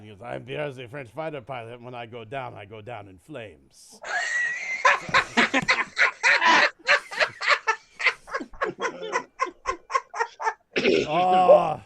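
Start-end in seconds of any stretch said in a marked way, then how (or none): 0.2-4.0 suave mock French accent sinking lower into the punchline; hammed-up French accent. Joke teller voices Pierre's punchline, mock French accent. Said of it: "I'm gonna leave you guys with a French joke". 4.0-11.0 high, loud real howling laugh; loud laughter. Real, not acted. Joke teller bursting out laughing at own joke, howling.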